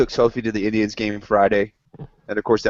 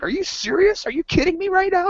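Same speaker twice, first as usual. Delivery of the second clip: high incredulous cry, pitched way up; retold disbelief. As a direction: replay the shock at a friend's claim, much higher. Retelling his disbelief at Adam.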